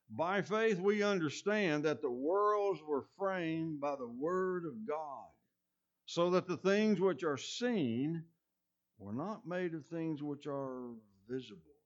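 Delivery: measured pulpit reading of the verse; scripture reading. Acted. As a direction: proclaim each phrase slowly. Reads Hebrews scripture aloud.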